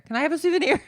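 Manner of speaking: put-on high, loud child voice; excited little kid pestering a parent; eager begging kid. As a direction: pipe up loudly, pleading for a treat. Begging kid voiced again, louder.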